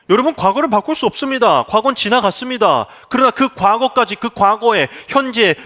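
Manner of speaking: loud, rising sermon climax; full-force preaching. Acted. Preaching rises.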